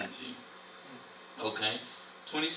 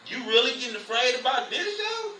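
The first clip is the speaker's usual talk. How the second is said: higher, amused mock calm; a higher, laughing teasing voice; Yahusha teasing; 8 semitones higher than usual. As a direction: coolly poke fun at a scared disciple. Yahusha calmly teasing scared Peter.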